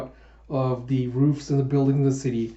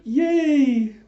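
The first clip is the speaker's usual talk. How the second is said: much higher, bright little cheer; excited cheer; 12 semitones higher, 4 dB louder than usual. Retold or imitated. Yona cheering about going into town, high and excited. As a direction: squeal with delight. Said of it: "Yuna's all excited".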